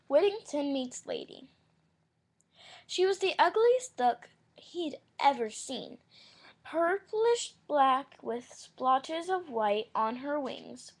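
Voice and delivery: loud, slow read-aloud narration; story reading. Acted.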